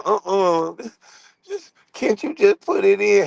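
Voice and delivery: high, put-on woman's voice; mock her lazy whine; hammed-up girl asking; imitating her. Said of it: "She told me".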